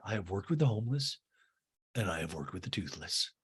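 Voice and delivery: low, soft, deadpan quote; mock solemn declaration. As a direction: state it gravely as a humble confession. Requotes Kathy's solemn line.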